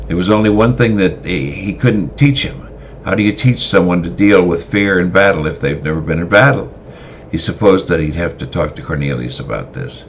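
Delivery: usual speaking voice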